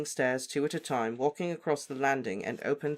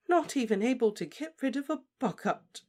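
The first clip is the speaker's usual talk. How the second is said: shaky; 11 semitones higher than usual. Acted. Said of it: "she said shakily".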